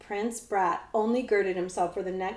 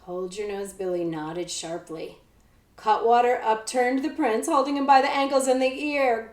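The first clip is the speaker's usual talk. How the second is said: usual speaking voice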